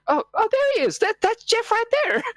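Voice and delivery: very high-pitched mock surprise; suddenly spot him, voice leaping up high; mock discovery. Player bluffs the goblins by pointing one out, mock surprise.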